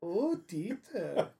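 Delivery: hammy German voice with a laugh; slow, high, laughing German caricature; mock German accent.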